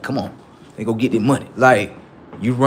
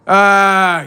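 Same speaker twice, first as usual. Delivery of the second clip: a high, loud yelp of disgust; 9 semitones higher, 10 dB louder than usual. Retold or imitated. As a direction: recoil with a sharp yelp. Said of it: "It's chicken".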